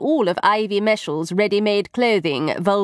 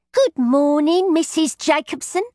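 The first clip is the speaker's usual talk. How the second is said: put-on cheery page boy; 9 semitones higher than usual. A narrator's reading. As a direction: call out like an eager page boy. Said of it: "cried the boy in buttons".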